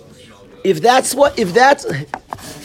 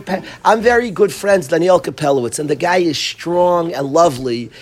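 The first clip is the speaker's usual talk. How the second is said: ordinary talk, nothing marked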